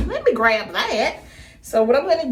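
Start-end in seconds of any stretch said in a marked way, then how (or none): 0.1-1.2 drawn-out, swooping impression voice; Joe Exotic. Vlogger reaching for heat protector in a playful celebrity impression. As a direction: put on a slow, lilting character drawl. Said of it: "In my Joe Exotic voice".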